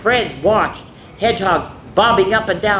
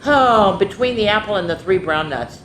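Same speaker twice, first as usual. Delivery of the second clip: ordinary delivery, nothing marked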